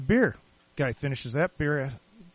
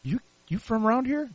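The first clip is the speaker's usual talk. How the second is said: higher-pitched, curious passer-by; imitated stranger; 8 semitones higher than usual. Stranger asks if he is local, curious. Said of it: "I get people now and then".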